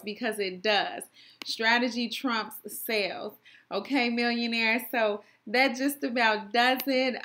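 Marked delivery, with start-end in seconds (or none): none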